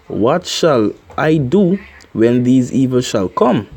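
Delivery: usual speaking voice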